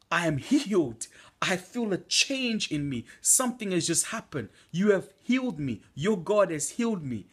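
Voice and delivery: put-on an old lady exclaiming her healing; ecstatic, tearful thanks; joyful cry. Old lady healed, crying with joy.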